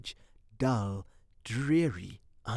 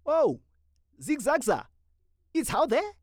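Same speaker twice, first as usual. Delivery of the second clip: loud, high greeting call; hailing friends; 12 semitones higher, 4 dB louder than usual. Acted. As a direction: shout across the platform to friends. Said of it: "hailed them".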